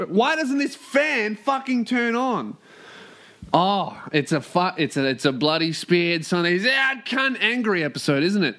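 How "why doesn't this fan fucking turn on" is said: high, drawn-out exasperated complaint. Real, not acted. Host fighting a fan that won't turn on, exasperated. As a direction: stretch out the frustration, pitched up.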